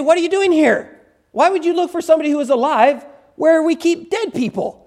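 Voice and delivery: high, emphatic angelic challenge; incredulous angels. Acted. Acting out angels questioning the women.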